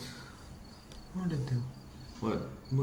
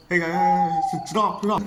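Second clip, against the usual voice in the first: raised, higher urgent call; 6 semitones higher, 5 dB louder, slower than usual. Acted. Calling the engines to stop, urgent. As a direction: call out loudly to make them stop.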